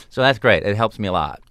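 ordinary talk, nothing marked

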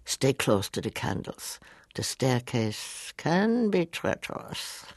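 slow, ominous weight on "can be treacherous"; slow, menacing hint that people were lost; Mel's line reading. Retold or imitated. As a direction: lean into "treacherous" slowly, as a warning. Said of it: "it was a line reading".

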